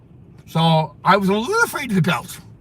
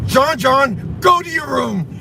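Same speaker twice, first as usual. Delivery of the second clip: father shouting; 7 semitones higher, much slower than usual. Retold or imitated. Retelling the father yelling at his son, shouted. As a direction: bellow it like an angry dad. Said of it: "And his father would go".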